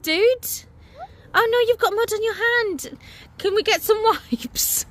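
high, sing-song parent voice. Real, not acted. Mother fussing over her mud-covered daughter, high sing-song. Addressing muddy toddler.

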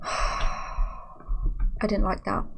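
slow, soft and breathless, after a sigh; sighing whisper. Real, not acted. Streamer tells chat she disliked the scare, whispered on a sigh. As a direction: let it out on a shaky sigh, barely voiced. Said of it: "If I'm being honest with you".

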